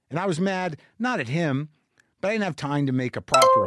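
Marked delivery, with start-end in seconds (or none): none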